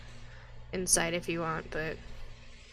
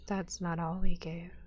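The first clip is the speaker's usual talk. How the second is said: whispered line; 2 semitones lower than usual. Acted. Priestess whispering a confession to the party. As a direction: breathe it like a secret. GM voices NPC reply.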